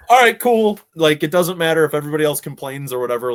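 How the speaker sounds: very high, loud burst of delight; elated reaction. Retold or imitated. Husband hearing his wife is pregnant, elated.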